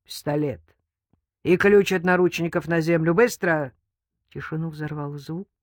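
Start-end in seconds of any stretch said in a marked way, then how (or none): 0.0-3.7 loud, urgent command; forceful gunman's bark; barked order. A narrator's reading. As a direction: demand instant obedience.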